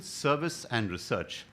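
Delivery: normal talking voice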